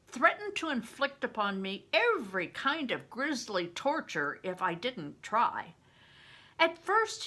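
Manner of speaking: ordinary delivery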